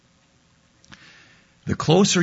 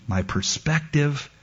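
ordinary delivery

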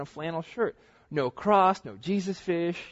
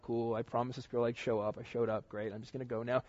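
softly, muttering his plan to leave; under his breath, eyeing the door; quiet retold thought, sneaking out; quiet inner aside; 4 semitones lower, 9 dB quieter than usual.

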